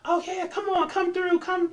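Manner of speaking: high, chirpy welcoming voice; eager guard. Retold or imitated. Security guard suddenly waving her through, eager. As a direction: wave her through cheerfully. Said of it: "And they're like".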